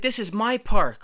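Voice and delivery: high, loud territorial claim; imitating an indignant holler; retold yell. Guy in a slide claiming the park, yelling. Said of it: "this slide is yelling at me".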